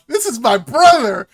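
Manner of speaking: exclaimed introduction. Retold or imitated. Acting out character greeting his sibling.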